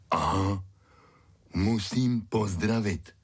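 slow, drawn-out big-dog voice; Fík. A narrator's reading. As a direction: recall it slowly as a talking dog. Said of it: "připomněl si Fík".

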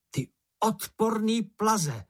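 high, drawn-out angry taunts; hollered insults. A narrator's reading. Mumínek taunting the poisonous bush, hollered insults. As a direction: hurl each insult slowly, pitched high. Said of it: "zahulákal mumínek".

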